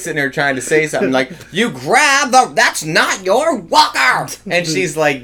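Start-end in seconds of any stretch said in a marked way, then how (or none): 1.5-4.3 put-on high, slow, loud old woman yell; old lady yelling. Old lady yelling over and over about her walker. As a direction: shout it like a cranky old lady. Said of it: "yelling this, like, over and over".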